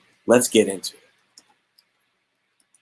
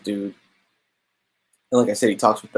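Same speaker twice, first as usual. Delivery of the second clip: normal talking voice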